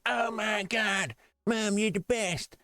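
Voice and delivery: put-on very high, slow gushing child; gushing kid voice. Mimicking an excited kid thanking mum for gifts, high squeal. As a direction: squeal like an overjoyed kid at Christmas. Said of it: "and like".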